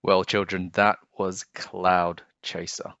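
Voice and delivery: ordinary delivery, nothing marked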